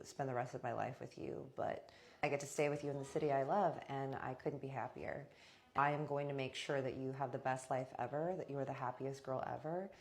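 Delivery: hushed, heartfelt vow retold; tender declaration. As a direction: imitate a devoted husband, quietly sincere. Voices husband's romantic declaration.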